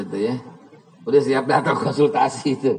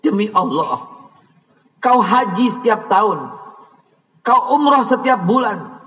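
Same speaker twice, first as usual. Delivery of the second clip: fiery preaching; 6 semitones higher than usual. Acted. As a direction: thunder at the ungrateful son. Preaching at full force.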